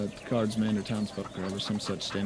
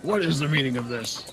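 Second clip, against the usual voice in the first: indignant mayor; 5 semitones higher than usual. Acted. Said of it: "He looks up and he's like".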